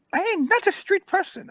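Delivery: very high, squealing mock protest; offended old woman. Woman denying she's a street person, high indignant squeal. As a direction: squeal it like an affronted old lady. Then acts out the woman's words.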